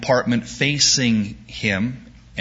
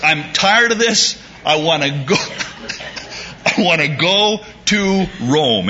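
fed-up Paul; 6 semitones higher than usual. Retold or imitated. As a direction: voice Paul who has had enough. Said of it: "so Paul says".

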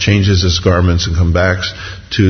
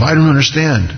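high-pitched, aggrieved rush of grievances; bitter complaint; 7 semitones higher than usual. Acted. Backslider blaming God when things go bad, bitter complaint. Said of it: "all of this bitterness".

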